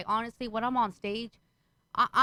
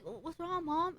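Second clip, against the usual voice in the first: put-on soft, higher child's voice; her son; 6 semitones higher, 9 dB quieter, slower than usual. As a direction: imitate a kid quietly asking mom. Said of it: "My goddamn son comes out the room".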